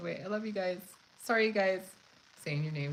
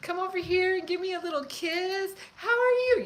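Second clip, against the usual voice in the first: flirty mom voice; 14 semitones higher, 7 dB louder than usual. Retold or imitated. Stoned mom flirting with shoppers, high sing-song coo. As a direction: coo at strangers like a tipsy mom. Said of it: "Yelling at everybody in the dollar store".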